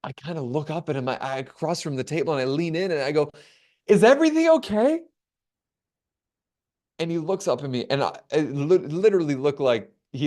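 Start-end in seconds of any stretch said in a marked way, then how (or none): 3.9-5.0 high, louder mock-nervous query; anxious high question. Traveler leaning in to the officer, anxious high-pitched question. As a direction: pitch it way up, as if worried.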